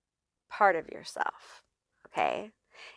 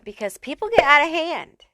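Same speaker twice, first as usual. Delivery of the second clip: usual speaking voice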